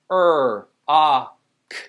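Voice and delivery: "er, a, k" as separate sounds; loud held "er" and "a", then a bare "k"; sounded out. Shown as a demonstration. Teacher sounds out rocket, sounded out. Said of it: "First syllable".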